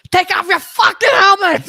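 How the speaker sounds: loud, high Bostonian yell; hammed-up angry Boston accent; Bill Burr. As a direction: bellow it in a thick Boston accent. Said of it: "in his Bostonian accent".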